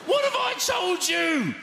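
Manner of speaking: high, slow nagging yell; scolding shout. Acted. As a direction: tell off the household, hammed up. Scolding about shoes.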